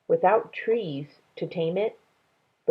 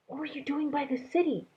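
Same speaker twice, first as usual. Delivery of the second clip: a lightly voiced girl, higher and softer, quick; Minley's voice; 9 semitones higher, 6 dB quieter, faster than usual. A narrator's reading. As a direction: ask like a curious, worried girl. Minley questions Dragon.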